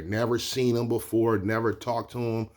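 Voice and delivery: usual speaking voice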